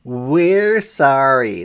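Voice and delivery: a firm but apologetic sorry; teachers apologizing. A narrator's reading. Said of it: "the teachers answer".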